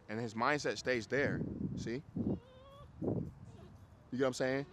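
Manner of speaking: ordinary delivery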